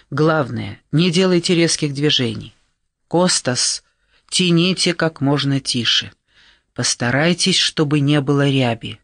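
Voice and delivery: ordinary delivery, nothing marked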